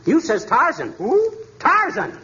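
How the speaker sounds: owl hoot. Acted. Husband hoots like owl.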